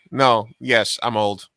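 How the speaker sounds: ordinary delivery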